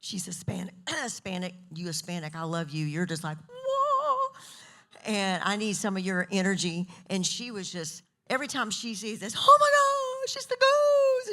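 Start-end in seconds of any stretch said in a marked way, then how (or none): 3.5-4.3 high, drawn-out "whoa"; excited whoa. Retold or imitated. Retelling a hotel lady's reaction, excited whoa. 9.4-11.3 very high, loud mock squeal; hammed-up shriek of delight; going crazy. Imitating the lady every time she sees this, wild excitement. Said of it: "she just goes crazy".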